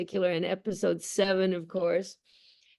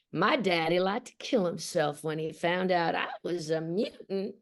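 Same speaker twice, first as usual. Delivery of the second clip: put-on Southern accent. Actress reading Rogue's audition paragraph for producers, in Southern accent. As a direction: read it with a lilting Southern accent. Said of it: "who can do a Southern accent".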